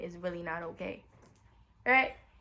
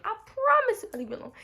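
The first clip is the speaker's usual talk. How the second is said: much higher, louder, faster repeat of the promise; pleading higher; 12 semitones higher, 5 dB louder, faster than usual. Retold or imitated. Begging Jason for honesty, a higher louder insistent promise. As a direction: plead harder the second time.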